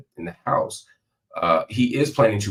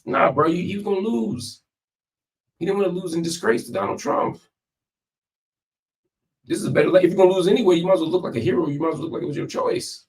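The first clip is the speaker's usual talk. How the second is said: scoffing; 12 semitones higher, 3 dB louder, faster than usual. Real, not acted. Scoffing at praise for Biden.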